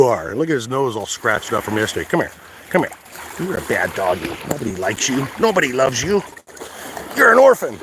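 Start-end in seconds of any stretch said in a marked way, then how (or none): 2.1-6.2 softer, playful mock scolding; mock scolding the dog. Real, not acted. Owner teasing his beloved dog, playful mock scolding. 7.2-7.8 much higher, louder playful jab; playful teasing squeal. Real, not acted. Owner playfully teasing his dog, high squeal.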